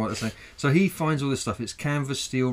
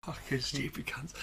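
muttering; 10 dB quieter than usual. Real, not acted. Muttered curse after skit.

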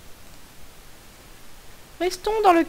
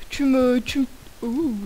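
usual speaking voice

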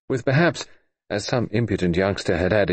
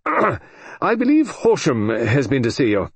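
Sir George; 5 semitones higher than usual. A narrator's reading.

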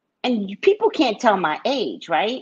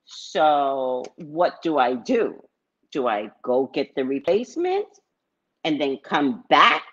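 not how it was said; 4 semitones lower than usual. Retold or imitated. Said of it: "I didn't say it like that".

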